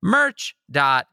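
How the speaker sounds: playful sales patter with lifted catchphrases; merch ad read. Acted. Host plugging podcast merch to fans, upbeat sales patter. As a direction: pitch the goodies like an eager hawker.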